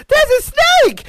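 very high, loud mock shriek; imitated scream. Host re-enacting the woman bursting in, high mock scream. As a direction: screech it like a panicked film character. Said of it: "she goes in and she screams".

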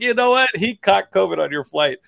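pointed, high-pitched gotcha; knife-twist jab. Retold or imitated. Telling airline staff their flight gave his dad covid, with a pointed jab. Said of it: "did a little knife twist on that one".

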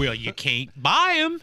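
slow country twang, lightly put on; lightly imitated country accent. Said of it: "she had this country twang".

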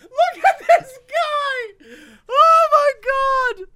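high loud laughing shout; laughing hysterically. Real, not acted. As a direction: crack up at the photo. Laughing at mugshot.

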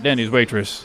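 low mumbled aside. Acted. Addresses waitress, dropping low.

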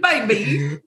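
put-on lightly British-tinged baby, laughing through it; mock Dorit accent. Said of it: "I love when she says it".

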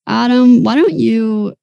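normal talking voice

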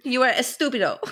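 playful mock Spanish accent; badly faked Spanish insult for laughs; Antonio Banderas. Teasing as the imagined Puss in Boots, mock Spanish accent. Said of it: "That's what I was picturing".

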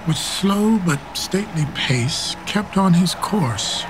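ordinary talk, nothing marked

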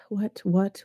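usual speaking voice

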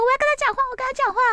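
squealing, swooning fangirl voice, very high; gushing Xiao S. Retold or imitated. Xiao S gushing on the phone about the narrator's husband, very high squeal. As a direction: gush over the husband like a lovestruck fan. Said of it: "然后说".

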